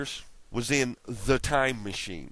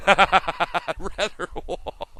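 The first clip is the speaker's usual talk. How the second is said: louder and higher, laughing it out; a giggling echo of I'd rather walk; 6 semitones higher, 5 dB louder, much slower than usual. Real, not acted. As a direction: repeat it slowly through a laugh. Laughing repeat of the quote.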